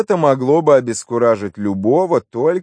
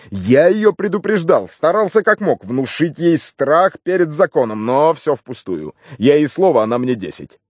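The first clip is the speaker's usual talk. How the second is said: higher-pitched, animated complaint; a hint of an exasperated policeman; lightly voiced aggrieved inspector; 6 semitones higher than usual. Inspector complaining about the defiant maid, mildly exasperated.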